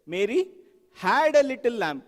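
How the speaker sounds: "had" stretched and punched after a pause; stress on HAD. Shown as a demonstration. Teacher showing the next emphasis, "had" punched hard. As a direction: hold off after "Mary", then hit "had". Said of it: "Emphasize the next word".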